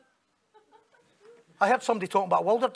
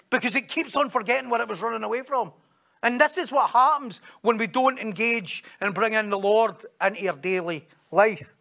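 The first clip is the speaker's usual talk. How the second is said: ordinary talk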